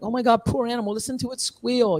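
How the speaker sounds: high, gasping pity; high mock dismay at the squeal; mock horrified outsider. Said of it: "someone looking and is like".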